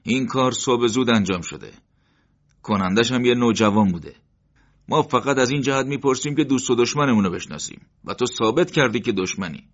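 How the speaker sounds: mild mocking threat; sneering. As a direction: talk down to him with contempt. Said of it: "مرد نیشخندی زد".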